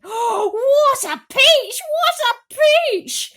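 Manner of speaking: very high, loud, slow delighted cries; excited cries. A narrator's reading. Aunt hops round the tree, squealing with delight. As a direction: squeal over the peach. Aunts cheering the peach.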